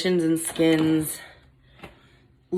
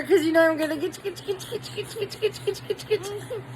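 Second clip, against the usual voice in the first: playful singsong; 13 semitones higher, 4 dB quieter, faster than usual. Real, not acted. Mom teasing her toddler, high playful singsong.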